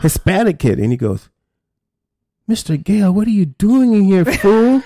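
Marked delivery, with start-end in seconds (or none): 2.5-4.8 put-on higher, teasing call of a kid who recognizes him; a hint of a cocky young inmate calling out; lightly voiced surprised kid. Former client spots his old social worker, a lightly teasing higher call.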